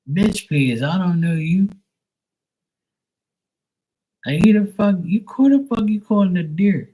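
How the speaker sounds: high, heated comeback; indignant retort. Retold or imitated. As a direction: snap back at a stranger, pitch climbing high. Acted indignant retort to stranger.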